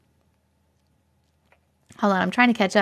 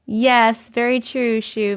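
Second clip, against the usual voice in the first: ordinary talk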